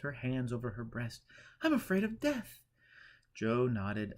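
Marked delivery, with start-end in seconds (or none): 1.6-2.6 much higher, trembling, high-strung pleading; fearful Eleanor. A narrator's reading. Eleanor confessing her fear to Joe, much higher. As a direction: beg for help in dread.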